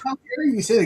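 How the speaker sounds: high-pitched imitation of a tearful outburst; mock crying. Mom reacting to dad insulting her friend, mock crying. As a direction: wail it as a crying mom, half laughing. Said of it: "My mom just spontaneously started crying".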